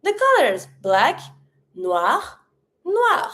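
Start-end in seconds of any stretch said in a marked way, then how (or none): 1.8-3.3 noir ending on a French R; French "noir" as nwahr, both alike; sounded final R. Shown as a demonstration. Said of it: "I do pronounce it".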